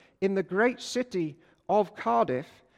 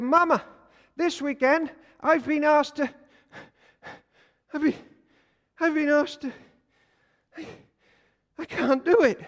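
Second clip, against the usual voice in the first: put-on panicky little boy; 8 semitones higher, 4 dB louder than usual. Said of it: "little Jack went home and he said".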